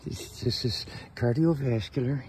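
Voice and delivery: cat voice. Pretend. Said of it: "she says".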